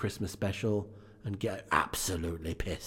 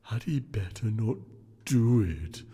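unearthly voice; slower than usual. Acted. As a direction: intone it like a solemn spirit. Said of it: "returned the ghost".

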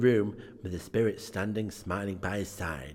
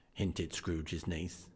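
ordinary delivery, nothing marked

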